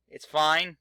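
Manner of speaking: fine said loud to convince yourself; forced cheer on fine; emphatic. Shown as a demonstration.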